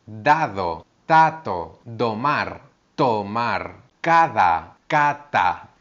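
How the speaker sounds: teacher minimal pairs d and t; d versus t contrast. Shown as a demonstration. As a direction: contrast each pair clearly. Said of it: "Con la D y con la T".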